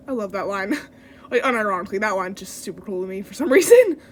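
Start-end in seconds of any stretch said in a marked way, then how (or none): none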